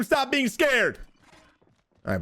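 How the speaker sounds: ordinary delivery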